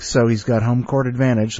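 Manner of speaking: ordinary delivery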